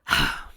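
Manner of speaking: heavy sigh. Real, not acted. Reader gives up.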